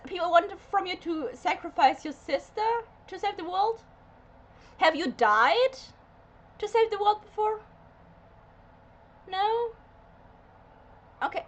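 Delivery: high, pointed mock-innocent questions; sarcastic questioning. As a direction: quiz her as if the answer is obvious. Sarcastic questions aimed at Anya.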